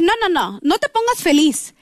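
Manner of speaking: urgent mock no-no-no; retold protest. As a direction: imitate cutting someone's joy short. Cutting off boyfriend's happiness in quote.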